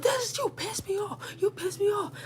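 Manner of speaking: very high, squeaky mock crying; retold crying yell. She cries while yelling at me, squeaky mock wail. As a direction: imitate her wailing complaint, pitched right up. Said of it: "she started crying while she's yelling".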